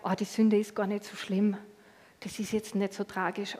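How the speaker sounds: soft, coaxing downplaying; Satan's soothing lie. Satan coaxes the believer, softly downplaying sin. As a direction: lull them into thinking sin is harmless. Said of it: "so wie Satan oft arbeitet".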